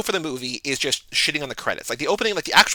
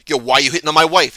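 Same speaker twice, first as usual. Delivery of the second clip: loud, fast bro confrontation; hammed-up jealous husband, louder; mock angry bro; 8 dB louder, faster than usual. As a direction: bark it like a jealous dude. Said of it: "being like".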